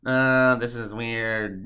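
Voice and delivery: slow, drawn-out uneasy groan of words; mock weirded-out. Showing what the connection was not, mock weirded-out. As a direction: act put off, dragging it out. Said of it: "You know, it's not like".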